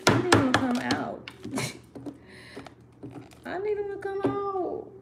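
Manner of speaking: high, whining plea to stuck cards; bratty, pleading whine; whiny pout. Real, not acted. Struggling with stuck cards, a bratty whine. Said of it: "now I'm gonna pout".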